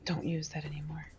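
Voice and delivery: soft, voiceless whisper; whispered warning. Acted. Player warns the character off the smashed jug, whispering.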